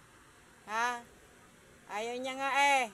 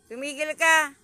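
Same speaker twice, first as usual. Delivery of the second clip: loud order; 5 semitones higher, 14 dB louder than usual. Real, not acted. Ordering cat to stop.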